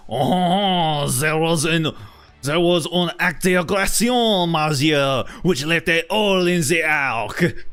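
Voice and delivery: Lumiere-style French accent; French-accented Lumiere candelabra voice. Shown as a demonstration. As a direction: put on a hammy French accent. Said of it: "the way I do my French accent".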